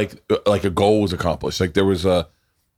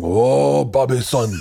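put-on accented father voice; drawn-out mock father's lament; Donnell; 4 semitones higher, much slower than usual. Host imitating Donnell playing Bobby's father, hammed-up accent. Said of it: "Donnell just starts going".

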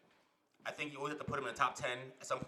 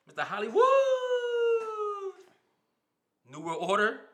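louder, higher hype call; hype announcer shout; 8 dB louder than usual. Real, not acted. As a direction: announce it like a wrestler entrance. Hyping nickname, louder.

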